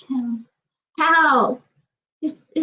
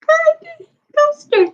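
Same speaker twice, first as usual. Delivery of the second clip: high, fast indignant question; outraged disbelief; 13 semitones higher, 4 dB louder, faster than usual. Real, not acted. Reacting to the couple on screen, high outraged question. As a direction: fire it off in disbelief.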